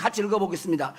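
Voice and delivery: normal talking voice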